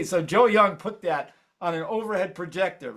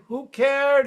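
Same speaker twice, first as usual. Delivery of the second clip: louder refrain; 10 semitones higher, 4 dB louder than usual. Acted. Rising chant of the refrain.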